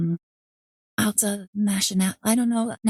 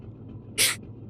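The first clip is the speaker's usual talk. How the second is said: sharp gasp; 8 dB quieter than usual. Real, not acted. Shocked at sudden arrest.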